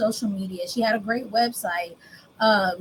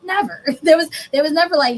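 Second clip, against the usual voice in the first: laughing emphasis; 6 semitones higher, 5 dB louder than usual. Real, not acted. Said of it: "laughing emphasis on never".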